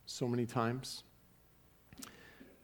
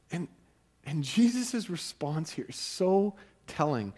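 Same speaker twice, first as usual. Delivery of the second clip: ordinary delivery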